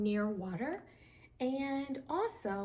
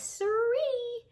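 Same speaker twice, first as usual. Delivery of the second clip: high, louder perky sirree; perky affirmation; 12 semitones higher, 6 dB louder than usual. Acted. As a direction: pop up the word proudly. Otter proudly confirms.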